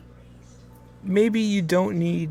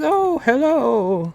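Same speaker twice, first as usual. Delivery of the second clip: mother's voice; 9 semitones higher, 4 dB louder, much slower than usual. Acted. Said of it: "He says".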